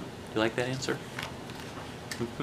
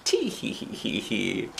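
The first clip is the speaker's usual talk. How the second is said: playful grandma with a drawn-out tee-hee; giggling grandma; 9 semitones higher, much slower than usual. Acted. Grandma admits it to her grandson, playful giggly voice. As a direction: start high, then drop into a slow mock titter. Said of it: "And she says".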